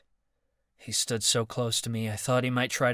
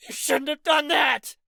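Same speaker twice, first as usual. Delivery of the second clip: much higher, louder furious cry; raging; 6 dB louder than usual. Acted. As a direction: rage at him. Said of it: "Marcus raged".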